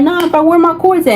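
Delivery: woman shouting at her ex for her cords; heated demand. Retold or imitated. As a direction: push back while he grabs her. Fighting ex over her cords.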